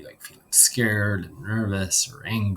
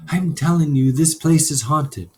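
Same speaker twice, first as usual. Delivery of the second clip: put-on loud, higher insistent boy; a spooked kid insisting loudly; 7 semitones higher, 6 dB louder than usual. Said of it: "came another boy's voice".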